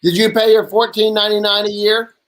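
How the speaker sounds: normal talking voice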